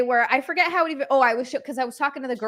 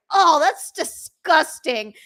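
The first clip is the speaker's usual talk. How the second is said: loud, drawn-out, higher-pitched disgust; mock disgusted; 5 semitones higher, 5 dB louder, much slower than usual. Gym bystander butting in about licorice, exaggerated disgust. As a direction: imitate an appalled bystander, stretching the words slowly.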